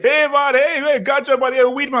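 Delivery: high, loud mocking fake Jamaican accent. Schoolmate mocking a Nigerian boy, high loud fake Jamaican. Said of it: "you were like".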